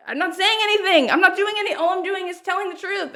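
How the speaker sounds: a touch of mock indignation; defensive protest. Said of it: "I'm like".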